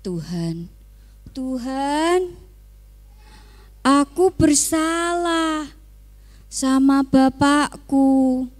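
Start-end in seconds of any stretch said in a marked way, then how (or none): 1.3-8.5 tearful prayer to God, higher and slow; a sorry son weeping to God; crying. A narrator's reading. The youngest son crying to God, pleading for forgiveness. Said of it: "menangis dan bilang kepada".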